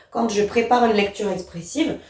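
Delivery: usual speaking voice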